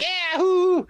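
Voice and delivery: very high, drawn-out mock cheer; elated whoop. Young performer cheering inwardly at winning the part, elated whoop. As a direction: whoop as if secretly triumphant. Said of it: "but inside, I'm like".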